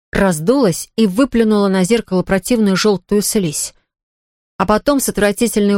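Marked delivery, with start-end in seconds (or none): none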